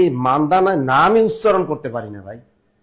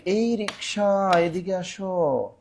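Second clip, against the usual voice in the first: calling a rickshaw; 3 semitones higher, 6 dB quieter, much slower than usual. Shown as a demonstration. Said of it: "আমরা কি বলি?".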